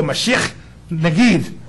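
Mashiach Nagid with a throaty Hebrew ch, slow; Hebrew pronunciation. Shown as a demonstration. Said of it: "you gotta spit like they do and go".

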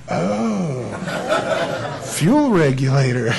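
slow words breaking into laughter; laughing sheepishly. Retold or imitated. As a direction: chuckle it out like a caught dad. Grill blows up on dad.

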